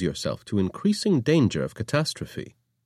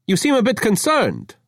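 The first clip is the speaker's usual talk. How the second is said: chirpy innocence; 13 semitones higher, 4 dB louder than usual. Acted. Avra's high chirpy voice.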